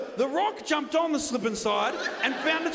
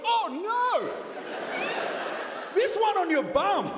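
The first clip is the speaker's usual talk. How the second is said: masseuse's dismay; 7 semitones higher than usual. Retold or imitated. Comic imitating the masseuse finding the rock on his bum, high alarm. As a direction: cry it out as if shocked. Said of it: "I hear her voice go".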